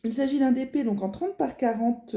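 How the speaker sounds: ordinary talk, nothing marked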